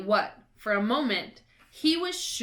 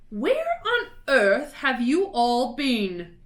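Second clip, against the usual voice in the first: louder, slow, indignant matron; Fat Lady; 4 semitones higher, 7 dB louder, slower than usual. A narrator's reading. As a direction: scold the late pupils. Fat Lady scolds children.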